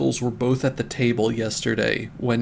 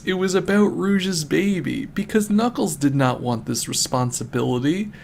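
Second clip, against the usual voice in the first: ordinary delivery, nothing marked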